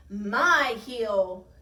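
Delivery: loud, drawn-out monster grunt. A narrator's reading. Bigfootosaurus about to squash the snowman, loud grunt. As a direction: grunt it loud, claiming your ground. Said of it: "Bigfootosaurus grunted again".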